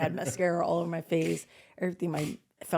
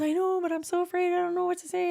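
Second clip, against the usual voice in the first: put-on scared kid; 12 semitones higher than usual. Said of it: "She goes".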